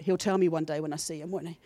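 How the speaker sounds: ordinary talk, nothing marked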